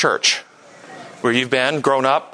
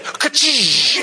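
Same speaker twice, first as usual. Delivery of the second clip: whip crack; 11 semitones higher than usual. Retold or imitated. Whip crack sound in story.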